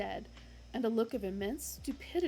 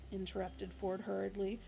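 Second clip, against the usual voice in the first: plain reading voice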